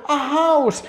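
high, loud burst of storyteller awe; hammed-up amazement for toddlers; amazed wonder. Shown as a demonstration. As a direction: announce it as the most spectacular thing ever. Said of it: "como si fuera la cosa más espectacular del mundo".

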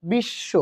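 বিশ্ব split into two beats; syllable by syllable. Shown as a demonstration. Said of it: "দুইবার উচ্চারণ করতেছি".

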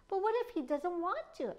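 fretful bird voice, slightly high; worried. A narrator's reading. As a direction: ask it with a touch of worry. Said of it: "asked the worried Judge Fowl".

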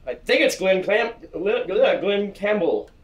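hammed-up game show contestant. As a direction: stretch out the answer like a TV host. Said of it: "name the song in your best game show voice".

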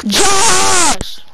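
loud high shout of a name; calling out. Real, not acted. Shouting to teammate Josh across the map, loud call. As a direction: yell for him to hear you.

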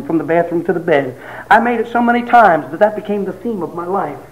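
ordinary talk

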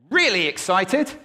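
sudden startling shout; excited, very loud. Shown as a demonstration. Speaker showing volume to the audience, excited shout. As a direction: blast it out with excitement. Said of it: "by using volume".

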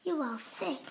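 plain reading voice

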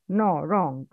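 usual speaking voice

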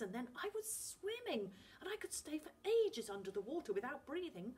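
put-on boy gushing about the sea; words boiling out. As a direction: tumble it all out in wonder. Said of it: "Finn's words were boiling out of him".

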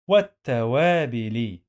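والتوابل syllable by syllable, long "waa"; sounded out. Shown as a demonstration. Teacher drilling learners on reading, sounded-out والتوابل. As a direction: stretch والتوابل out as wat-ta-waa-bi-li.

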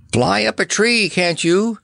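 high, exasperated shout; lion yelling at the bird in his face; roaring. A narrator's reading. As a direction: roar at the flapping bird. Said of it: "roared the Cowardly Lion".

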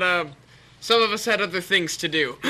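ordinary delivery

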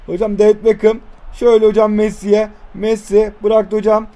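ordinary delivery

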